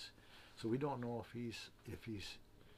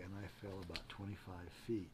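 usual speaking voice

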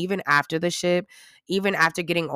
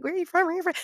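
quick, high-pitched mock small talk; imitating eager passengers; 12 semitones higher, faster than usual. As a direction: lightly mimic people excitedly asking around. Mimics chatter of cruise passengers.

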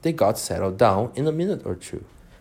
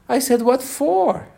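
"What for?" high and drawn out; insistent correction; 4 dB louder than usual. A narrator's reading. Alice corrects the Rabbit, "What for?" high and emphatic.

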